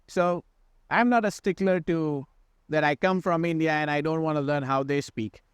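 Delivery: usual speaking voice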